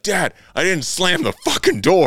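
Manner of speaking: high, loud indignant protest; frustrated son. Retold or imitated. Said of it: "he's like".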